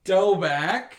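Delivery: slow, loud, drawn-out asking; hammed-up tentative wheedling; mock pleading request. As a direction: stretch out a hopeful plea. Retold tentative request to parents.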